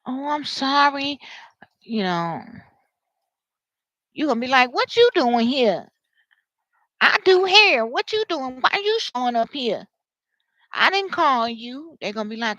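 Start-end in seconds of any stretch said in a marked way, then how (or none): 0.0-1.2 higher, drawn-out phony sorry; fake apology. Giving you some fake apology, insincere and drawn out. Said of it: "some fake ass apology". 4.7-12.0 indignant challenge. Acted. Acted confrontation with unwanted visitor.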